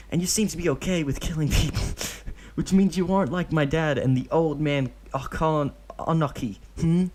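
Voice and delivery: put-on a cheeky kid reasoning it out; eager child. Deidara arguing with Obito, high eager child voice.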